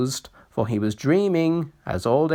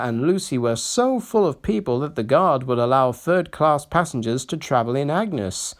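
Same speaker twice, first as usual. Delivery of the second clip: plain reading voice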